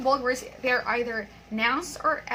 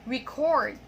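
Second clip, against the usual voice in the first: record as a verb, re-CORD; stress on second syllable. Shown as a demonstration. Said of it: "we stress the second syllable".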